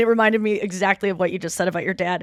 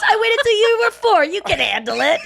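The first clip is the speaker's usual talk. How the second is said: much higher, louder dude voice through a laugh; surfer dad; 11 semitones higher, 5 dB louder than usual. Retold or imitated. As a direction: shrug it off, totally chill. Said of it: "And he goes".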